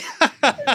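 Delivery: bright burst of laughter, high. Real, not acted. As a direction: laugh out loud. Guest laughs at own remark.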